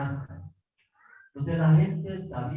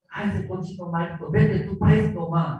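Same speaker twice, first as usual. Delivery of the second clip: mock insult; 5 semitones higher, 4 dB louder than usual. Locals insult foreign pastor.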